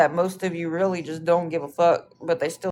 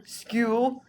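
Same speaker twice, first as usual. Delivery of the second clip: mocking question; 5 semitones higher than usual. Real, not acted.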